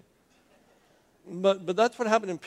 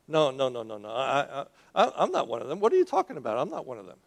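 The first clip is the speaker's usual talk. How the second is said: flustered denial; 2 semitones lower than usual. Acted. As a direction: play a flustered man denying it, half laughing. Voices Peter's flustered denial.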